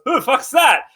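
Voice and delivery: high-pitched startled question; startled guard. Acted. Guard spotting a berserk emu, startled. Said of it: "as one of them goes".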